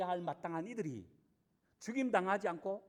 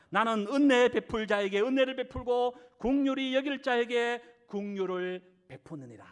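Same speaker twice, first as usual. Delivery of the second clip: louder, measured reading of God's words; solemn scripture reading; 4 dB louder than usual. Shown as a demonstration. Preacher reading Exodus aloud to the congregation, solemn and loud. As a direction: proclaim the verse with weight. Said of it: "읽어드립니다".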